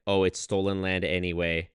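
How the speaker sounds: usual speaking voice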